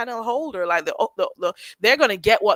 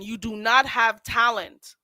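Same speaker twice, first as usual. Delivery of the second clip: usual speaking voice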